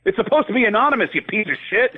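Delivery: high, fast mock outrage; mock angry tweet. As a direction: scold him like an angry troll, hammed-up. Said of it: "people on Twitter will come after".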